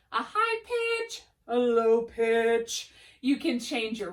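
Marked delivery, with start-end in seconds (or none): 0.1-1.2 high pitch. Shown as a demonstration. Teacher showing students a high pitch, much higher. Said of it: "So you can change your pitch". 1.5-2.9 slow, low demo voice; low pitch. Shown as a demonstration. Teacher showing students a low pitch, slow and low. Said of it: "So you can change your pitch".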